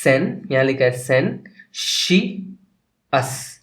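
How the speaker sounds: सेन, शी, अस as separate pieces; pauses between सेन, शी and अस; syllable by syllable. Shown as a demonstration. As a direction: sound out सेन, then शी, then अस. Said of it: "combination of words पे करनी है".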